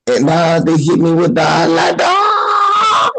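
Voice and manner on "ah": mock pained yelp.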